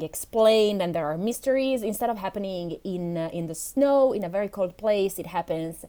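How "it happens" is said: ordinary delivery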